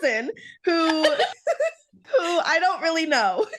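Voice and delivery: can't keep the laugh in. Real, not acted. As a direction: try to introduce her while cracking up. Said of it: "I'm already laughing".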